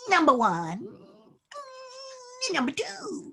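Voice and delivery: put-on high whiny crying baby dinosaur. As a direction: wail like a straining toddler dinosaur. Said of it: "And Baby's gonna go".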